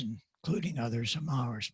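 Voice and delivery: ordinary talk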